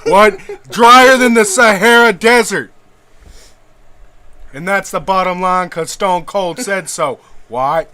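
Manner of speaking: loud, swaggering wrestler promo with shouted what? after every line; Stone Cold impression. Podcaster riffs as Stone Cold about Ben Shapiro's wife, loud wrestler promo. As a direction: bark the promo like a beer-chugging wrestler, yelling what? on each pause. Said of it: "it's hard to get a real Stone Cold tangent".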